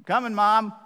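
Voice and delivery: high, loud reluctant reply; kid calling back. Retold or imitated. Son calls back to his mom, loud and reluctant. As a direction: call back to mom from the other room.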